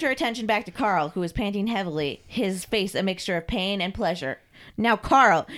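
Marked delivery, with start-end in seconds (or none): 4.8-5.5 loud, curt order; sharp command. Acted. Mistress orders Carl to obey, sharply. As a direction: call him to attention.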